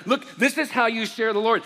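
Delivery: loud, high, hammed-up showing off; overexcited mock witnessing. Pastor mimicking an over-eager witness to the congregation, hammed-up loud. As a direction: ham it up like a proud soul-winner.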